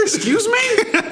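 high, laughing disbelief; incredulous. Retold or imitated. Son retelling his reaction to mom's request, incredulous. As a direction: squeak it out in disbelief. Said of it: "I was so annoyed".

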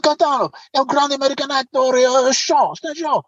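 high-pitched Italian announcing a star; imitating a starstruck Italian cop; excited Italian policeman. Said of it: "He said".

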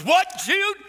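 very high, loud mock kid yell; grandchild yelling back. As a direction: blurt it back at full volume, imitating a child. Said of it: "He goes".

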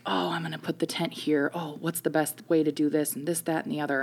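hushed, half-whispered imitation of someone busy; under-the-breath impression of a diligent camper; diligent busy muttering. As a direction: mimic him fussing softly to himself. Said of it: "Chris is diligently like".

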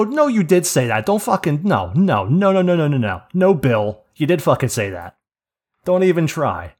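loud, repeated shutting-down of a denial; retold indignant contradiction. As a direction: re-enact cutting off a coworker's excuse. Retold argument, cutting off denial.

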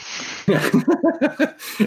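high laugh bursting out; burst of laughter. Real, not acted. Laughing at the shoes joke.

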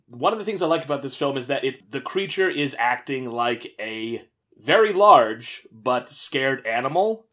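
usual speaking voice

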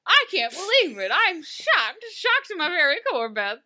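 high, loud fake astonishment; mock shock. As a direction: pretend to be utterly scandalized. Sarcastic feigned shock at obvious twist.

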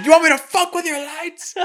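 high, loud mock rant at the lighting guy; retold actor shouting at the lighting guy, furious; imitated furious yell. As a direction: blow up like a star losing it on set.

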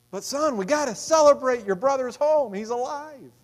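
high, loud, pleading father; loving father. Acted. Father urging the grumbling elder son, high and loud. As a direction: lift it up high to win him over.

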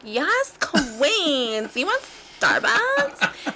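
put-on high, slow, stretched-out affected girl; Becky voice. Mother offering Starbucks to her daughter, Becky voice. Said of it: "we call it the Becky voice".

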